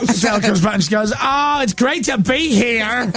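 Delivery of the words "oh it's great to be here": mock American accent. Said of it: "she sounds all American".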